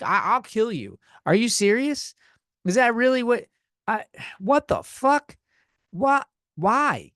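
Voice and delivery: high, incredulous sputtering outrage; appalled and pleading for sense; exasperated disbelief. Retold or imitated. Retelling outraged reply to manager.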